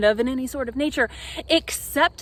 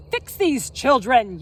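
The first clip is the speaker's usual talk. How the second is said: yelling father; 7 dB louder than usual. Acted. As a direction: bellow it at the wizard. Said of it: "yells my father".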